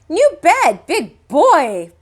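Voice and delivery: put-on high, loud little-kid boast; proud toddler. A narrator's reading. Fudge boasting to mom about his bed, high toddler voice. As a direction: brag like a three-year-old.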